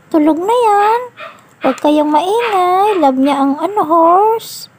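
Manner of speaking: put-on high sing-song voice for a toddler; high baby talk. Caregiver lulling Ritz to sleep with the toy horse, high sing-song. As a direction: coo it up high like talking to a little one.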